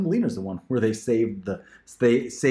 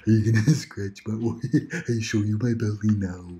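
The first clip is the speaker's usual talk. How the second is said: dumb dog voice. Acted. Auditioning mom voices a dumb happy dog. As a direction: play a sweet dim dog begging for scratches. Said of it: "then sort of dumb dogs".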